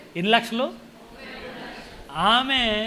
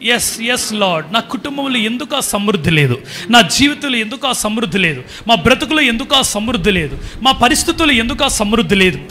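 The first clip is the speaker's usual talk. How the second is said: pleading prayer that grows louder; fervent prayer building; 4 semitones higher, 10 dB louder than usual. Shown as a demonstration. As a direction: model prayer rising from steady to loud. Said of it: "ఒకసారి కన్నీళ్లతో యేసయ్య పాదాలు కడుగుదాం".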